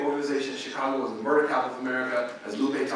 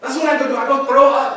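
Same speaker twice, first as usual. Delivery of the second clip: higher and louder, shocked friend confessing; half-acted shocked exchange between friends; upset friend; 10 semitones higher, 6 dB louder than usual. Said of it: "her best friend's like".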